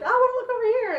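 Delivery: high, cartoonish little voice; talking eye. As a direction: pipe up as a stubborn eyeball. Voicing one wandering eye.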